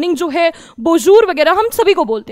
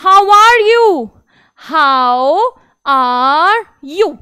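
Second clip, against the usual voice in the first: How are you said very slowly; slow teacher drill; slow English model; much slower than usual. Shown as a demonstration. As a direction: stretch each word of How are you. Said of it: "ça va यानी कि कैसे हो?".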